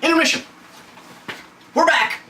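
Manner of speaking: high-pitched intermission announcement; mock announcer call-out. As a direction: call it out like a showman. Mock show break announcement.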